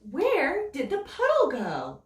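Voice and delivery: usual speaking voice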